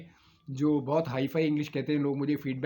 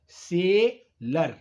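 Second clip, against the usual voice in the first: Seller drawn out slowly in two separate syllables; split into syllables; 3 dB louder, much slower than usual. Shown as a demonstration. Teacher showing a two-syllable word, seller split slowly in two. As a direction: break seller into two slow beats, से and लर. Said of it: "दो syllable वाला देखिए".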